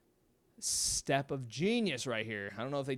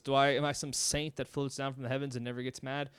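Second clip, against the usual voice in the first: normal talking voice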